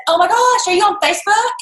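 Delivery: high, excited retold question; eager exclamation. Recruiter to recovery nurse she just met, eager. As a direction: pitch it up like a delighted discovery.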